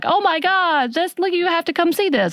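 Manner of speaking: high-pitched imitation of urgent excitement; excited call. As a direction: act out an amazed "come look" call.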